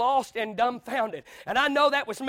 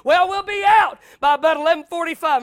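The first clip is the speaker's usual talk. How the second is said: boasting pastor; 4 semitones higher, 9 dB louder than usual. Retold or imitated. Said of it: "and he said".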